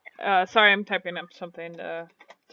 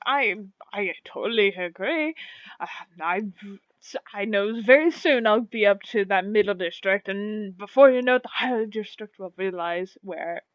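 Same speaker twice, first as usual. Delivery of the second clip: flattered, boastful tailor. Acted. Tailor agreeing with an admirer about his talents, self-satisfied. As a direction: revel in the praise as a proud tailor. Game master voices the tailor.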